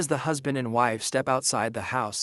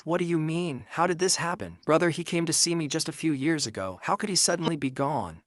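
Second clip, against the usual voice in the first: ordinary delivery, nothing marked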